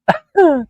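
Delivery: a burst of high, loud giggles; real giggle fit, loud and high. Real, not acted. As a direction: crack up at your own joke. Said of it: "I get myself the giggles".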